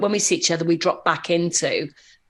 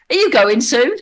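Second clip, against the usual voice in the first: loud, raised-pitch schoolchild; kid's blunt question; 5 semitones higher, 7 dB louder than usual. Retold or imitated. Pupil to a visiting speaker, loud and blunt. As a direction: pipe up loudly like a tactless pupil.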